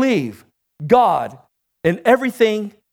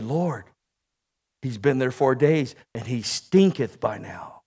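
low, hushed and much softer, almost under the breath; hushed mourners; 9 semitones lower, 8 dB quieter than usual. Acted. Sisters quietly warn Jesus at the tomb, hushed low. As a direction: murmur it like grieving sisters at a grave.

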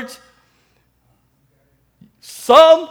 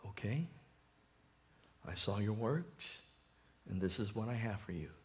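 low, very soft voice of God; the Lord speaking; 10 semitones lower, 18 dB quieter than usual. Acted. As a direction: pronounce judgment softly and low. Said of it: "And the Lord says".

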